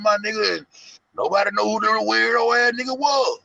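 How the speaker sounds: high, loud coaxing voice; pleading caller. Retold or imitated. Zach calling Chosen to smooth things over, high and loud. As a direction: talk him down, buddy. Voicing Zach's phone call.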